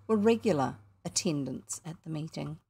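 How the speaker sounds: usual speaking voice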